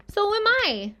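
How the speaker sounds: a second tiny spider chiming in. A narrator's reading. Another baby spider answers Wilbur, tiny and high. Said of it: "said a third voice".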